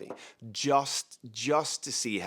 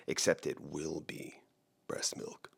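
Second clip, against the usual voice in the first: whispered punchline; hushed gleeful whisper; 5 semitones lower, 7 dB quieter than usual. Pretend. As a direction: breathe it out as a secret reveal. Punchline of imagined prank.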